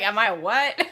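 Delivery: loud, offended comeback; indignant retort. Retold or imitated. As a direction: fire the question back, insulted. Retelling Catherine's indignant reply.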